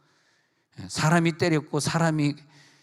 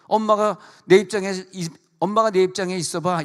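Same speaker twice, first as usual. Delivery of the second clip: higher, louder daughter snapping at mom; indignant outburst; 6 semitones higher, 4 dB louder than usual. Retold or imitated. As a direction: throw it back at her mother. Daughter lashing out at mother.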